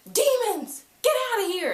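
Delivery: high, hammed-up banishing order; commanding shout. Clary banishing demons, commanding shout. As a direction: call it out high like dismissing demons.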